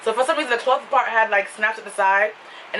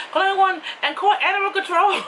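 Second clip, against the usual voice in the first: high, giggling call for help; laughing mock plea; 9 semitones higher than usual. Speaking into the reporter's microphone, laughing mock plea. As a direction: beg for rescue, half laughing.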